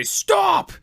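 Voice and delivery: sudden, very high-pitched shouted command; sergeant major yell. Acted. Sergeant major halts soldier walking in barracks, loud yell. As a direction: yell it across the barracks to halt someone. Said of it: "yell at the knuckleheads".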